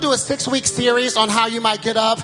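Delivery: full-force preaching. Real, not acted. Preacher calling the congregation to get up, full-force preaching. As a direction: build relentlessly toward a rallying cry.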